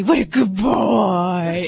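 slow, sing-song praise to a dog; baby talk. Retold or imitated. Vet baby talking to her dog Boss, slow and sing-song. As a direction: coo it like praising a clever dog. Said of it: "there's certain things I'll say".

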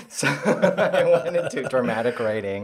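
laughing through the words. Real, not acted. Admitting her dreams were crushed, laughing. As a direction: chuckle it out, rueful.

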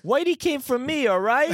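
high, strained dramatic character voice; stage-play acting. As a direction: deliver it like an overwrought play line. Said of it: "doesn't this sound like a good play".

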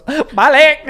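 loud, high mock Jamaican; mock Jamaican accent. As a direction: blurt a bad Jamaican accent. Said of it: "Do a Jamaican accent".

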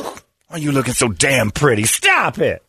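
ordinary delivery

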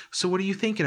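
high, gently prodding question; coaxing him like a patient; humouring friend. Retold or imitated.

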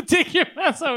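high, laughing through the words. Real, not acted. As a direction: giggle the line out. Laughing repeat of friend's joke.

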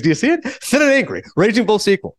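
high-pitched, insistent director's pitch; excitable Marty Scorsese. Retold or imitated. Director hammering his movie idea at Albert Brooks, excitable. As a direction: sell it eagerly, pitched well up. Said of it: "then Marty walks out".

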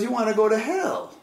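high-pitched, insistent imitation of a tempter; intense persuasion. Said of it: "he was really intense".

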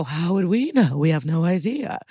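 put-on innocent voice, sing-song; mock innocent. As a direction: imitate suspects playing clueless. Acting out suspects feigning ignorance.

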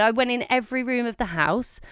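ordinary talk, nothing marked

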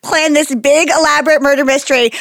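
ordinary delivery

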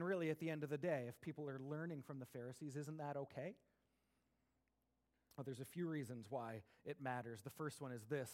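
ordinary talk, nothing marked

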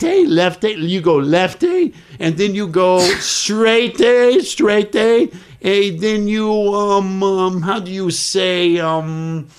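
hammed-up Italian giving a tourist bogus directions; fake Italian accent. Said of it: "I am totally B S ing this poor guy".

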